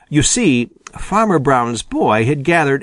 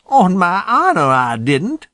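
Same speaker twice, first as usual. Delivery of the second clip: high, louder protest of innocence; mildly acted indignant protest; 7 semitones higher, 3 dB louder than usual. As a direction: swear on your honor, voice jumping up. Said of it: "protested Unc' Billy".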